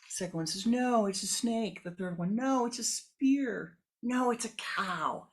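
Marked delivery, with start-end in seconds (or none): 0.7-1.8 higher, louder insistence; quarrelling blind man, higher and louder; arguing. Acted. 2.3-5.3 higher, louder, slow insistence; heated blind men, higher and louder; arguing. Acted.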